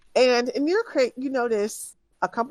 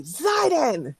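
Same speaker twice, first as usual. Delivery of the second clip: loud angry yell of a name, pitched high; angry shout; 6 semitones higher than usual. Acted. Caravan woman angrily yells Zidon's name, furious shout. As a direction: bark the name out in a temper. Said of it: "But angrily and loudly".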